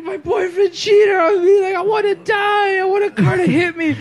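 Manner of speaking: hammed-up despairing cry; mock distraught wail. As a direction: imitate a heartbroken woman wailing. Imitates distraught woman in the street.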